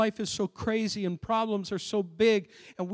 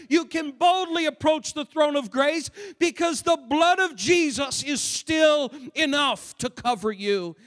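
higher, driving pulpit delivery with stretched key words; forceful preaching; 7 semitones higher, 5 dB louder than usual. Real, not acted. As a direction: build with conviction, hammering each phrase.

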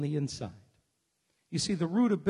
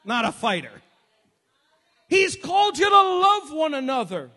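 high, loud shouted preaching climax; fiery preacher climax; full-force preaching; 11 semitones higher, 10 dB louder than usual. Acted. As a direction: shout the sermon to its peak.

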